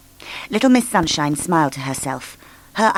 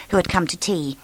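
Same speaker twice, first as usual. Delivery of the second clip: ordinary delivery, nothing marked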